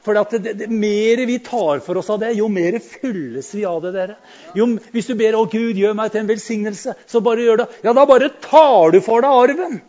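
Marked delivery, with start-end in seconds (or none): 4.6-9.8 louder, rising preaching push. Acted. Preacher urging the congregation to grab the inheritance, loud preaching. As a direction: drive it home to the congregation.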